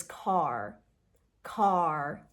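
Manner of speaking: "car" with a heavy final R; "car" drawn out, R strongly sounded; strong American R. Shown as a demonstration. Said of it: "It's a very strong ra sound".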